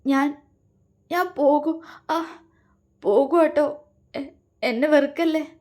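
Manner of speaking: halting, broken words between gasps; dying gasps. A narrator's reading. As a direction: struggle for breath as she fades. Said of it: "മരണവെപ്രാളത്തോടെ പറഞ്ഞു". (മരണവെപ്രാളത്തോടെയുള്ള വാക്കുകൾ)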